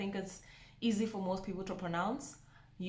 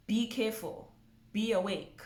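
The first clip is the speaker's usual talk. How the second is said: ordinary talk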